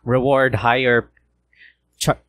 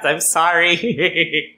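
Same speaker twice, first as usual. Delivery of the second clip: laughing apology; 3 semitones higher, much slower than usual. Real, not acted. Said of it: "my voice is kinda shot".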